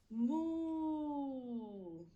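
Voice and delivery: drawn-out cow moo; playful teacher moo. Shown as a demonstration.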